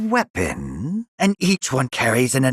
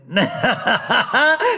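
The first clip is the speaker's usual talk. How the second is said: high, rising villain giggle; delighted cackle; 7 semitones higher than usual. Acted. Scientist eyeing a promising specimen, delighted cackle.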